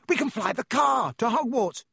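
high, excited near-shout; excited proposal. A narrator's reading. Stranded boy proposes flying the car to school, excited. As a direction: burst out with the daring plan.

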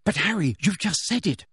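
high, insistent objection; pointed, exasperated protest; fierce. A narrator's reading. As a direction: argue back fiercely. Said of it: "said Hermione fiercely".

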